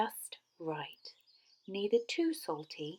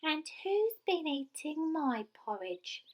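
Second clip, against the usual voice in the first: high little squeaky voice; squeaky Baby Bear; 9 semitones higher than usual. A narrator's reading. Baby Bear crying over his porridge, squeaky. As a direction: pipe it up like a tiny bear. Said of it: "in his squeaky little voice".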